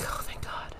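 very soft, breathy mock relief; whispered relief. Acting out relief at being let off.

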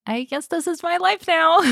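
high, defeated little voice; high resigned voice. Retold or imitated. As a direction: lift it up, giving in.

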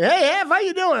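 cheesy greeter. Retold or imitated. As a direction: play the overeager guest. Imagines greeting Hef at the door.

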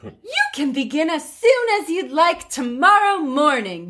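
light, sweet fairy godmother; lightly voiced fairy godmother. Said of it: "That was more fairy godmother than queen".

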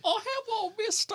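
high, eerie little orphan voice; creepy child voice. Acted. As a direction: play a spooky burnt child.

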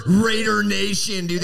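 hammed-up superfan rallying cry; mock Raiders fan. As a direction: mimic a fan's slogan, stretching it out. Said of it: "that fucking cliche of".

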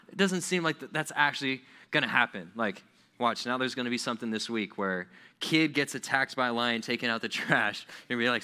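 normal talking voice

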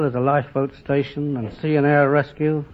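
normal talking voice

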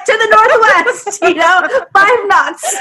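slow, high, laughing sailor talk; laughing pirate voice. Pretend. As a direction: play the pirate while giggling. Imagining pirate steering, laughing.

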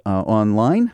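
ordinary talk, nothing marked

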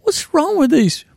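high, loud incredulous complaint; retold dismay, voice shooting up; exasperated outburst. Retold exasperation at scribbled boots.

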